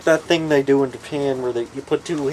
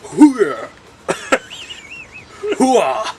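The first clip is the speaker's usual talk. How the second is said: loud, high military whoop; loud gung-ho soldier; 13 semitones higher, 4 dB louder than usual. Pretend. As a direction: cheer like a hyped marine.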